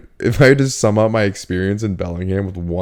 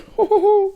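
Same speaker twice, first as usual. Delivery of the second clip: high falsetto giggle. Retold or imitated. Roommate impression laugh.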